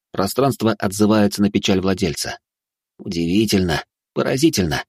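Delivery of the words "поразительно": ordinary delivery, nothing marked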